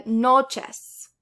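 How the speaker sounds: noches stressed loudly on NO, NOches; stress on second-to-last syllable. Shown as a demonstration. Teacher giving learners an example, stressed NOches. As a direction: punch the first syllable of noches. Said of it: "The second to last syllable".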